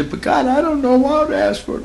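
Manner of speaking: high pleading complaint; mock pleading voice of a doubter; whiny doubter. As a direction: plead like a helpless believer. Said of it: "Well, you say".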